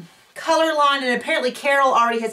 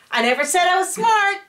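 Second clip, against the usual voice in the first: mock tagline; 8 semitones higher, 3 dB louder than usual. Suggested tagline when caught being dumb.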